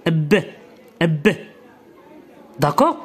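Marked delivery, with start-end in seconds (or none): 0.1-1.3 ب as a bare consonant, "ebb", no full vowel after it; B with sukun. Shown as a demonstration. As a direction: stop on ب with a short released b. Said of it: "أقف عليه بالسكون".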